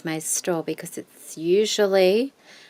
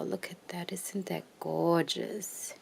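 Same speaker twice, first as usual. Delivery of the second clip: whisper=40; 7 dB quieter than usual. Real, not acted.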